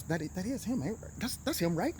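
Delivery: quietly double-check with a colleague; unsure murmur to a partner; hushed disbelief. Retold or imitated. Stunned, asking partners to confirm.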